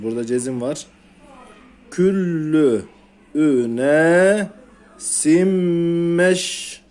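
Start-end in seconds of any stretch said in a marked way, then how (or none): 1.9-6.8 Küllü üne simmeş spelled out in slow, separate pieces; slow recitation demo. Shown as a demonstration. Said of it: "Tekrarlayalım".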